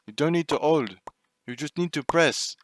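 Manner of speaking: put-on a French-accented dad explaining; dad's French accent. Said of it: "Imitate your dad's French accent".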